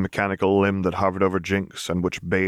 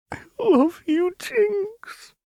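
high, slow, crying declaration; sobbing; much slower than usual. Acted. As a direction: break down crying on her name. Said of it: "Lux sobbed".

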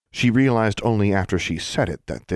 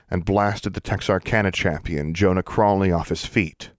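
ordinary delivery

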